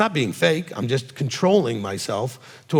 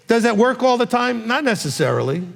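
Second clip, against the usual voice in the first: ordinary talk, nothing marked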